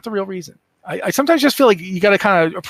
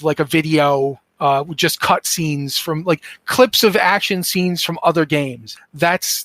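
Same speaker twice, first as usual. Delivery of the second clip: ordinary talk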